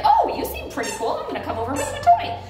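very high, eager dog voice; voicing the dog. Owner voicing her dog coming over, very high and eager. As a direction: speak for the dog as if he's won over. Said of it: "he's like".

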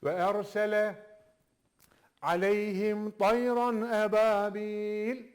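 slow chanted recitation; Quran recitation. Shown as a demonstration. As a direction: recite the verse slowly, with tajweed. Recites verse. (yavaş tecvidli tilavet)

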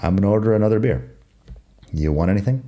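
fast, run-together "I'm gonna order" as "am n order"; fast tipsy mumble of "I'm gonna" as "am n"; slurred after three beers. Shown as a demonstration. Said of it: "claro que lo digo así".

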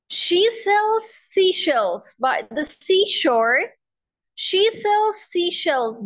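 slow, deliberate She sells seashells; slow tongue twister. Shown as a demonstration. Teacher slowly modelling She sells seashells for students. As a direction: drag each s and sh sound out slowly. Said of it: "practice with me".

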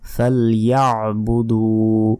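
ফালইয়াবুদু slowly, "ya'" caught in the throat; measured recitation, sounding the ain in ফালইয়াবুদু; clear throaty ain. Shown as a demonstration. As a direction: stretch the final "duu" of ফালইয়াবুদু. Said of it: "দুতে এক second টান হবে".

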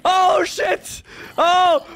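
high-pitched alarmed yelps; sudden high panic; panicked cries. Real, not acted. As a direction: cry out in real alarm. Alarm again during the argument.

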